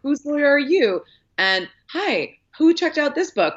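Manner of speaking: high, rapid-fire curious questions; eager reporter impression. An earnest reporter grilling strangers with eager questions. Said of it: "He's just like".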